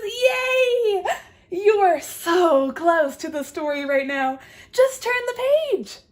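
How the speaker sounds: high, loud cheer with laughter; excited bird. Acted. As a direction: squeal it out like an overjoyed little bird. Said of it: "The bird says".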